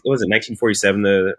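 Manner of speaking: ordinary talk, nothing marked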